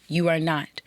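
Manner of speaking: ordinary talk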